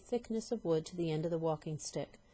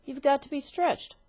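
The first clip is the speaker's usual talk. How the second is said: higher, louder sinister verdict; sinister husband; 8 semitones higher, 6 dB louder than usual. Acted. Husband announcing the cure, sinister. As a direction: announce it with relish. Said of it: "said Mister Twit".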